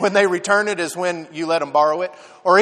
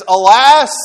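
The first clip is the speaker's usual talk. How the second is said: retold cry; 2 semitones higher, 8 dB louder than usual. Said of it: "He says".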